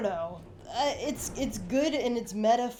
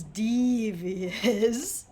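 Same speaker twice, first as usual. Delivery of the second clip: slow drawl; 3 dB louder than usual. Real, not acted. Drawn-out slow reaction.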